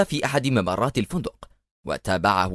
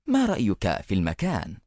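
plain reading voice

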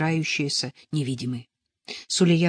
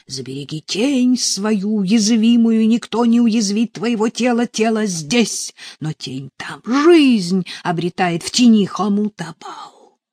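loud, raised, chanting priest; priest's invocation; 5 semitones higher, 7 dB louder than usual. A narrator's reading. Priest invoking over the victim, loud incantation. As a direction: proclaim it like a ritual spell. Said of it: "взывает жрец".

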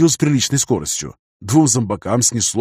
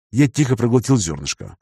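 ordinary delivery, nothing marked

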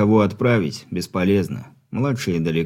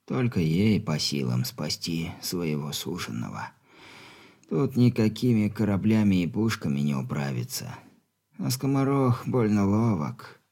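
put-on much softer frail old man; weak stern dying father; 7 dB quieter than usual.

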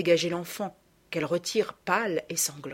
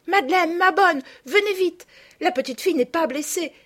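much higher and louder, calling out in relief; joyful cry; 10 semitones higher, 6 dB louder than usual. A narrator's reading. Camille calls her sister and the nurse, overjoyed and loud. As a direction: shout the good news across the road. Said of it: "un cri de joie".

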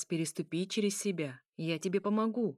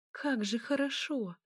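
ordinary delivery

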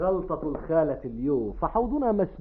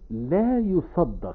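"لا يصدق" drawn out slowly, higher, falling; imitating her catchphrase; 4 semitones higher, much slower than usual. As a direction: mimic her stretched "لا يصدق". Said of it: "إنها إنما تقلدك". (تقليد مطّها لعبارة "لا يصدق")

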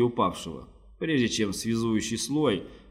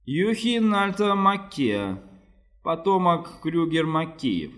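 slow, higher haughty lord; haughty nobleman; 6 semitones higher, slower than usual. A narrator's reading. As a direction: announce your noble line slowly. Ruiz poses as noble.

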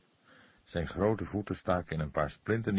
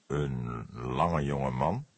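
pondering; 5 semitones lower, much slower than usual. Acted. Cook's slow pondering question.

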